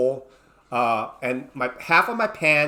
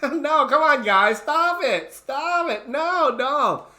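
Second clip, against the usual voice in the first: mock panic; 9 semitones higher, slower than usual. Women chasing him, tearing clothes.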